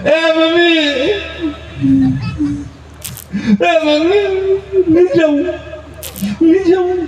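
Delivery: high wailing plea of a crying bride. Retold or imitated. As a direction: wail it like a girl begging her mother. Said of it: "रो रही है".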